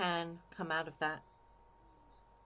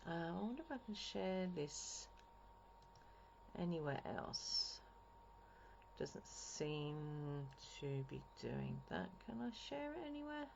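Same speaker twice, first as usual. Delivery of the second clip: softly, half under the breath; talking to herself; 10 dB quieter than usual. Real, not acted. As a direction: think aloud quietly while busy checking something. Talking to self while checking sharing.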